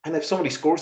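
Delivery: normal talking voice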